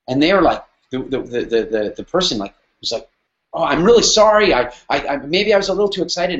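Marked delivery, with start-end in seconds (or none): 3.5-6.4 sheepish apology. Retold or imitated. The supplier caught out, apologizing to Eric, flustered and sheepish. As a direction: play the caller backing down fast. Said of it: "and immediately apologized".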